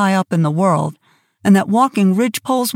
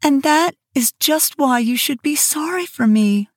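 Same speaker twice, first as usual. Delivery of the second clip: Anne's voice; 6 semitones higher than usual. Acted. Anne replying.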